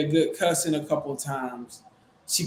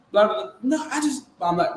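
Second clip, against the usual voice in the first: ordinary delivery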